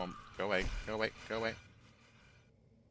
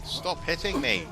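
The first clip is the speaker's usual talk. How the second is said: shouting; 8 semitones higher, 7 dB louder than usual. Real, not acted. Being attacked in game.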